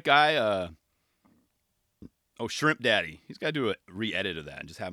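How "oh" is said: normal talking voice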